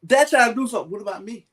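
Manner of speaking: mock protest. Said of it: "And I'm like".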